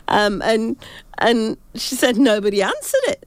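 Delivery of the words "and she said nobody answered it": ordinary talk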